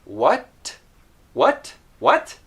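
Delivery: "What" with the final t released crisply; "What" asked with a distinctly sounded final t; very clear T. Shown as a demonstration. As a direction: finish "What" on an audible puffed t. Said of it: "you can hear the T very clearly".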